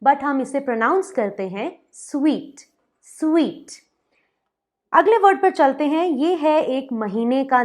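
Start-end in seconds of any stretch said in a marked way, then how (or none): 1.9-3.7 स्वीट said like "sweet"; "स्वीट" rhymes with sweet, not "soot"; correct suite. Shown as a demonstration. Teacher tells viewers to say "स्वीट" like sweet. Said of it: "spelling होती है S U I T E".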